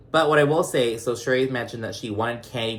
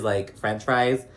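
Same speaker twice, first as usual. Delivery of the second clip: ordinary talk, nothing marked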